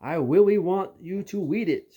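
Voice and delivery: lightly voiced little frog-voiced kid; froggy little kid. A narrator's reading. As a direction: beg like a tiny kid with a frog voice. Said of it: "her voice sounds like a frog".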